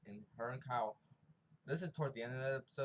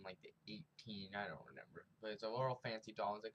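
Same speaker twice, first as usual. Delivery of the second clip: ordinary talk, nothing marked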